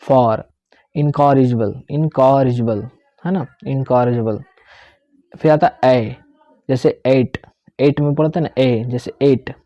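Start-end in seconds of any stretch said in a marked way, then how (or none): 0.9-4.4 incorrigible said slowly, syllable by syllable; repeat-after-me teacher demo; pronunciation demo. Shown as a demonstration. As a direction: model incorrigible for students to repeat. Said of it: "जैसे मैं बोल रहा हूँ वैसे आपको भी बोलना".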